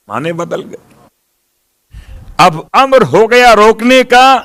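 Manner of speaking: ordinary delivery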